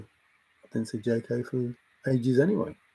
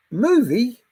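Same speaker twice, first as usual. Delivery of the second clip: incredulous; 9 semitones higher, 4 dB louder than usual. Real, not acted. Reacting to chat's suggestion.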